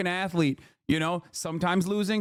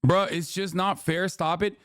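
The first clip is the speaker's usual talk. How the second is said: ordinary talk, nothing marked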